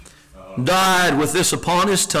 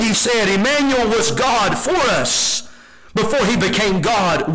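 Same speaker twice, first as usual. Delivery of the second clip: preaching at full force; 8 semitones higher than usual. Acted. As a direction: proclaim it to the whole congregation. Reads scripture at full force.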